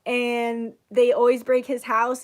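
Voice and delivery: ordinary delivery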